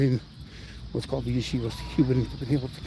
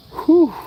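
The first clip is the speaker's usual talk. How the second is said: loud high exhale of relief; high relieved whew; 14 semitones higher, 8 dB louder than usual. Real, not acted. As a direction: blow out high and loud after effort. Exhale after hard ride.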